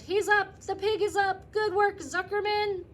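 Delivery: high, loud crowd cheering; yelling cheers. Acted. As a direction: shout joyfully from the stands. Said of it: "yelled the crowd".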